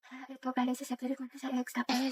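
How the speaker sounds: tiny, very soft murmur; barely audible. Acted. Classmate secretly helping with math, barely audible. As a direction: murmur it far too softly to hear. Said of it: "Sobrang hina ng boses mo".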